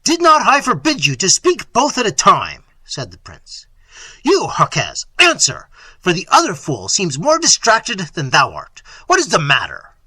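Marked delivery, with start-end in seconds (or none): 0.1-2.6 loud, high-pitched scolding; angry rebuke. Acted. Prince rebukes both servants. 4.2-9.9 loud, commanding interrogation; impatient master berating fools; exasperated prince. Acted. Voices Manfred, exasperated prince.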